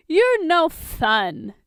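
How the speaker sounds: high, loud, slow teasing whine; Tony whining at Bruce, high and drawn out; playful whine. Acted. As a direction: drag it out like a pouting friend.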